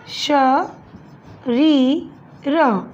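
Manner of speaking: श, री, र split with pauses; syllable by syllable. Shown as a demonstration. Teacher spelling शरीर for students, syllable by syllable. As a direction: spell the word out beat by beat. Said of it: "यहाँ पे शलगम वाला आएगा".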